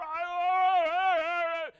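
loud high wailing oh, hammed-up crying; mock wailing. Preacher mocking self-pitying believers with loud wailing. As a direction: wail it out like a mourner overdoing grief.

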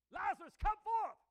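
loud, high command to the dead; booming voice of Jesus calling Lazarus. Acted. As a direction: boom it out like a summons. Preacher voicing Jesus raising Lazarus.

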